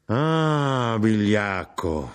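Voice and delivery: slow, stretched cry of contempt; drawn-out cry. A narrator's reading. Mother curses her absent husband, crying out slowly. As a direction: draw out the insult in outrage. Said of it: "gridò mia madre".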